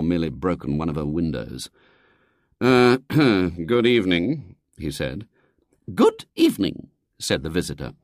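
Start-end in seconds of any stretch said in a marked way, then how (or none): 2.6-4.6 slow, hesitant greeting with throat-clearing; reluctant father. A narrator's reading. Father greets unwelcome visitor, reluctant and slow. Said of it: "Mister Brown groaned inwardly". 5.9-6.9 very high, formal lady's greeting; prim lady visitor. A narrator's reading. Visitor greets William's father, prim and high.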